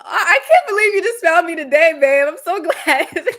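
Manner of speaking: high, loud giddy squeal with a laugh; mock fangirl squeal; gushing fangirl. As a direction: gush like a starstruck fan. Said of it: "I was like".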